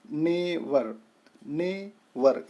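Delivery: नेवर in two beats, ने then वर; नेवर with a small gap between ने and वर; split into syllables. Shown as a demonstration. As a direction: sound out नेवर each time as ने – वर. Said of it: "इसमें दो syllables पाए जा रहे हैं".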